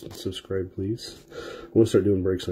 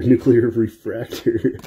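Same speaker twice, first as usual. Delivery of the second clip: drawn-out, louder correction breaking into laughter; laughing self-correction; 2 semitones higher, 5 dB louder, slower than usual. Real, not acted. Card breaker fixing his own tongue slip, slow and laughing. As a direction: slowly hammer out the right word, then crack up.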